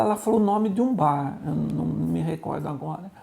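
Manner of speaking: ordinary talk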